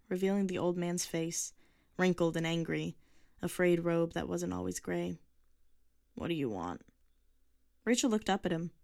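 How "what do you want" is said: put-on low, grumbling old man voice; a cranky old neighbour at his door; grumpy old man. A narrator's reading. Old man to the girl on his porch, low and gruff.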